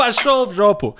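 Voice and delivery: high-pitched mock yell in Russian; mock scream. Someone screams back in the dubbed scene, mock yell. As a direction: play a lightly hammed-up yelling neighbor.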